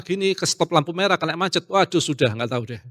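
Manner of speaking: ordinary talk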